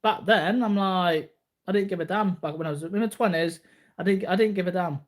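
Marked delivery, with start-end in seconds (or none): none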